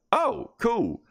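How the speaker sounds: a lightly voiced cheerful giant; Gardener. Gardener healed, lightly voiced and pitched high. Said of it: "You're like".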